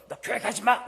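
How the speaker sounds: put-on a parent snapping at the child over church; angry parent.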